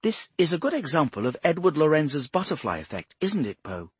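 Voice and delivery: high-pitched, insistent explainer; earnest Bradshaw. Acted. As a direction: pitch it up and press the point.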